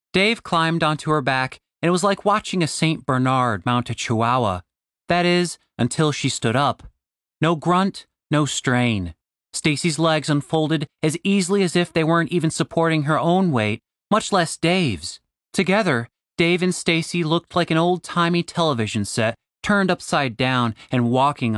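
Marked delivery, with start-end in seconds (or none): none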